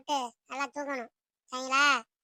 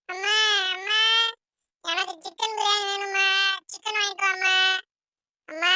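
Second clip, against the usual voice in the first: put-on whining, demanding child; 3 semitones higher, 5 dB louder than usual. Second child demands chicken biryani from mother, whining. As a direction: nag mother insistently, stretching each ending.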